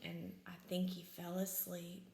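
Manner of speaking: hushed whisper. Real, not acted. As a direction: whisper so he stays asleep. Mole fell asleep.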